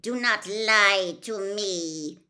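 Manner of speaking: slow, loud, forceful warning; angry hiss. A narrator's reading. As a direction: bear down on each word as a cold villain. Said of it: "hissed the second voice".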